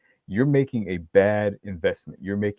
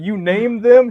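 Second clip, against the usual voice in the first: much higher and louder, incredulous; shocked disbelief; 13 semitones higher, 8 dB louder, much slower than usual. Retold or imitated. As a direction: blurt it out in stunned disbelief. Ex shocked that he named them.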